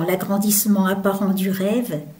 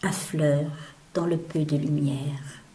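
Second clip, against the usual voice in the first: softer whisper, dying away; 4 semitones lower, 5 dB quieter than usual. Acted. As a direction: let it fade into the dark. Poem line fades into dim light.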